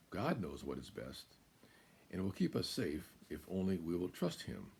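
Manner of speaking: plain reading voice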